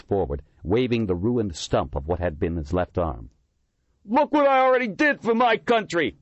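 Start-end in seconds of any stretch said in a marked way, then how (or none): 4.1-6.2 high, loud shriek, voice breaking; maimed veteran shrieking at the heckler. A narrator's reading. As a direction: shriek it, voice cracking. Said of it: "he shrieked".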